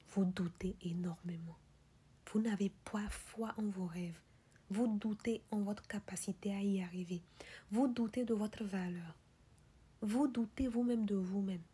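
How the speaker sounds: ordinary talk, nothing marked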